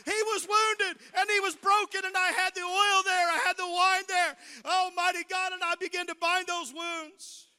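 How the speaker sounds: loud, high preacher's cry; preaching at full force. Acted. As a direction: lift the church with a ringing shout. Preaching climax.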